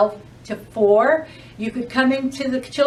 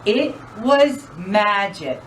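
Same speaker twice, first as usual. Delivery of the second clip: lively, sing-song storytime voice; animated picture-book narration; reading to children. Acted. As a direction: read it like a librarian to a room of kids.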